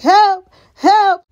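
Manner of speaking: high, loud mock shout; cry for help. Elgin running up to the truck, mock cry for help. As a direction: imitate someone shouting for rescue.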